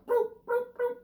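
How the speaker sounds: very high little bloops; fish bloop sounds. Retold or imitated. Comedian imitating fish going belly up, high bloops. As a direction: pop out bubbly bloops like dead fish.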